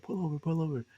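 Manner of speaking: high urgent call to pull over; urgent shout. Retold or imitated. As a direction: yell it to stop the car. Drunk asking friends to stop car.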